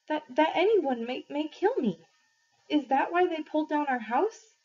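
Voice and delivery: words tripping as fear rises; faltering voice. Acted. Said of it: "my voice faltering".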